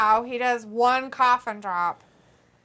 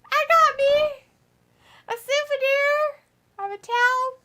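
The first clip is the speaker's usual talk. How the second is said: excited fan voice; 13 semitones higher, 6 dB louder than usual. Retold or imitated. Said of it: "That person's like".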